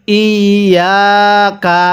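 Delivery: slow, drawn-out ইয়া কা; poprawna recytacja. Shown as a demonstration. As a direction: hold the doubled ya in ইয়া, then a separate কা. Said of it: "কাপ জবর কা".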